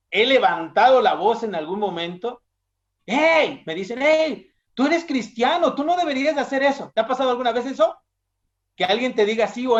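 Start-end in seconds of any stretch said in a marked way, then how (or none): 3.0-3.6 high, loud call-out; acted reproach. Acted rebuke from coworkers. 4.0-6.9 high-pitched, hurried scolding; acted reproach.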